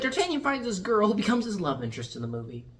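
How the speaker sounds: ordinary delivery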